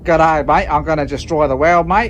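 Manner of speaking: mock Australian baddie. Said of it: "like, a".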